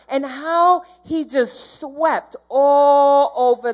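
slow, voice catching on the edge of tears; almost crying as she remembers; tearful. Real, not acted. Emotional testimony about God's presence.

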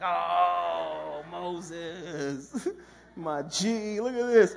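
bursting out, loud and thrilled, calling Moses; amazed shout. Pretend. Youth pastor imagining himself in the parted sea, shouting amazed to Moses. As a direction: yell it like you can't believe your eyes.

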